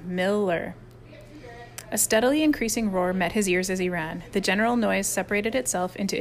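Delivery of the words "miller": MILLER grumbled, drawn out and falling; contained, seething mutter. A narrator's reading. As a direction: mutter "Miller" darkly to yourself. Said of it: "he muttered as he headed".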